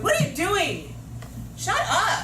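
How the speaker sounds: angry shout, high and loud; mad teacher. Shown as a demonstration. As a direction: yell it like a teacher who has lost it. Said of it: "Well, at that point, I'm mad".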